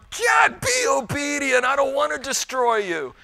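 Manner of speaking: high, loud shout that eases off at the end; pleading shout. Retold or imitated. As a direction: yell it like a parent at the end of their rope. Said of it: "He's up in heaven going".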